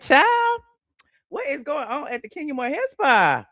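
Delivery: sudden high squeal on child, then mock outrage; mock shocked. Teasing the AI clip, a squealed mock-shocked child. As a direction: shriek the word child in fake shock. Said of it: "I'm like".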